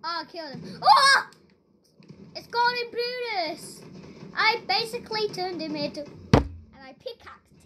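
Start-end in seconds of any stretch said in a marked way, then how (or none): 0.8-1.3 startled yell. Real, not acted. Killed an opponent.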